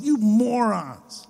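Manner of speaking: high, drawn-out insult without shouting; acted fed-up jibe, slow; mock exasperation. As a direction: stretch it in mild mock anger. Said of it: "kinda what Moses was saying".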